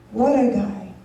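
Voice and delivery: normal talking voice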